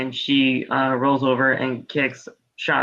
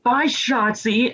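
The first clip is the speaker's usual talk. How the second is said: slow, high taunting farewell; mock goodbye; 7 semitones higher, much slower than usual. Ronda dismissing Shotzi after the move, mock goodbye. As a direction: draw out a mocking goodbye.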